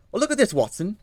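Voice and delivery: put-on high, loud London-accented Holmes; eager detective reading the news aloud. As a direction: play Holmes, keen and bright over the paper.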